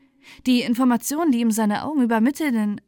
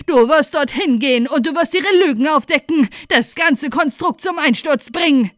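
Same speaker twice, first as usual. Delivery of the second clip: put-on loud, higher fiery old woman; zealous preaching; 4 semitones higher, 8 dB louder than usual. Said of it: "wie ein eiferner Prediger".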